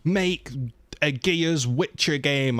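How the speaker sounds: lightly mocking gamer-bro voice; mock fan demand. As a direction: ham up a pushy fanboy's request. They're just like.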